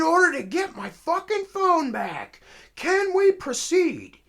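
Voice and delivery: very high, loud, deliberate acted tirade; furious rant. Caller demands his phone back from officer, furious. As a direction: throw it back at full volume, hammering each grievance.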